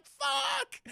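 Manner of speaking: very high, drawn-out mock scream; anguished cry. Peter watching aunt carried away, anguished cry. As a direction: wail the curse in comic despair.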